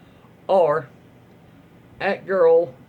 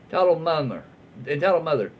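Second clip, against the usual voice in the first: usual speaking voice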